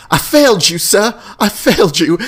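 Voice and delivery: high, loud mock-weeping butler; imitated crying. Butler at his master's grave, imitated tearful wail. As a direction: act out a grief-stricken breakdown. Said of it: "he's just crying".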